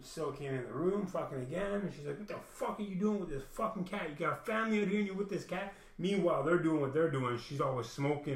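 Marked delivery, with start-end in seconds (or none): 2.2-5.7 higher, scolding mimic of her; retold rant about the cat; mock angry woman. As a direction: snap at him in her voice.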